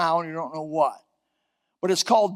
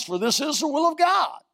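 preaching shout; 8 semitones higher than usual. Acted. Preacher climaxing a quoted verse.

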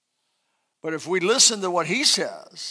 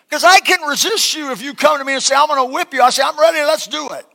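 loud, higher combative bravado; mock fighting talk; 8 semitones higher, 8 dB louder, faster than usual. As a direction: puff up ready to fight. Acting out a fight challenge.

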